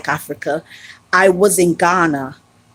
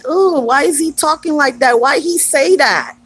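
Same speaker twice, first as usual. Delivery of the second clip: hurt reaction; 8 semitones higher than usual. Retold or imitated. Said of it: "I was like".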